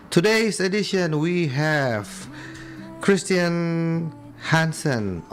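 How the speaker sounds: ordinary talk, nothing marked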